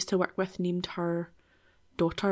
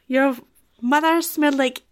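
put-on loud, higher French-accented taunt; hammed-up French accent; mock French accent; 5 semitones higher, 6 dB louder than usual. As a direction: fling an insult in a silly French accent. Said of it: "That was my best French accent".

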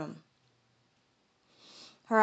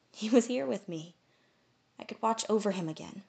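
usual speaking voice

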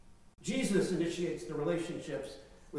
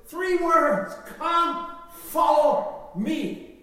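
proclaiming; 7 semitones higher, 6 dB louder, much slower than usual. Acted. Preacher proclaims Jesus' call.

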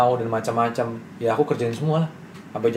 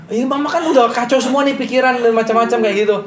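high, louder imitation of a worried mother; high mom voice; 11 semitones higher, 5 dB louder than usual. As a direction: play the fretting mom, pitched up. Mom's voice again. (suara ibu yang cemas, tinggi dan agak keras)

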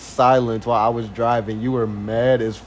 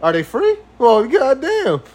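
excited rant; 11 semitones higher than usual. Acted. Boyfriend mocking her, thrilled by free toilets. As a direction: crow about free stuff.

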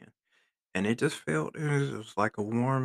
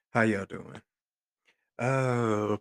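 ordinary talk

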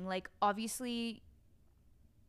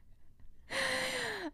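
sudden high-pitched giggle; a real laugh breaking out; high burst of laughter. Real, not acted. Hosts crack up after exhausting talk.

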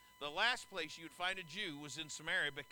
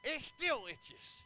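weary drawn-out complaint; 4 dB louder, much slower than usual. Acted. After sigh, drawn out.

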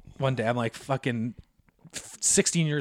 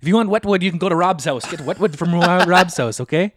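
loud, raised, impatient lecture; mock bossy dad; 5 semitones higher, 6 dB louder than usual. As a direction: lay down the law as dad. Dad lectures son about wood.

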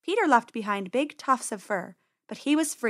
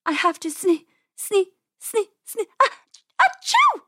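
halting, climbing pitch into a loud sneeze; acted sneeze overtaking the words; building sneeze; 10 semitones higher, 5 dB louder than usual. A narrator's reading. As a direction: stutter higher and higher until it bursts. Penny fighting a sneeze, then sneezes.

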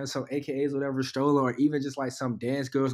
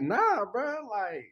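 high mock voice; 9 semitones higher, slower than usual. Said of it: "you was like".

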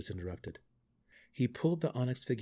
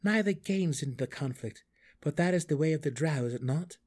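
lightly acted rhetorical challenge; a touch of raised defiance; defiant Drizzt; 6 semitones higher, 4 dB louder than usual. A narrator's reading. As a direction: reason aloud with an absent foe, pitched up. Drizzt challenges his absent mother.

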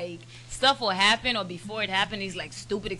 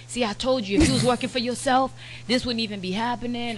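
higher, mildly annoyed told-you-so; retold mad husband; 4 semitones higher, 4 dB louder than usual. Husband chiding his wife about her job, mildly mad. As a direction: scold lightly, imitating a fed-up husband. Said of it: "and he'd be mad".

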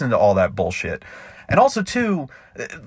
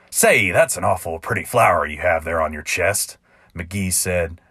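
ballad narration; 4 semitones lower than usual. A narrator's reading. Narrator reading his cowboy ballad to listeners, rhythmic and sly. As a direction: spin it like a campfire tall tale.

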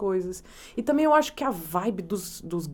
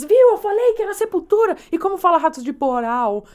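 excited Greek fans; 8 semitones higher, 8 dB louder than usual. Retold or imitated. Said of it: "Aí eles".

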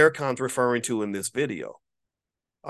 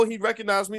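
ordinary talk

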